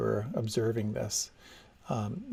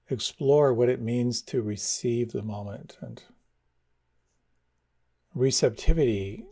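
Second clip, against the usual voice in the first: guided meditation. Acted. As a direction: ease them inward, unhurried. Leads a meditation.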